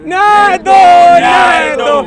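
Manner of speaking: slow, high, loud chanted call; slogan shout. Real, not acted. Activist leads protesters against the government, shouting. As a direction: shout the line for the crowd to answer. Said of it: "पूरी आवाज़ से बोलेंगे".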